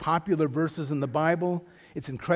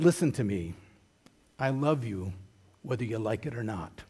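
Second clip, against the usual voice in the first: calm father; 6 semitones lower, 5 dB quieter than usual. Retold or imitated. Father answering a yelling son.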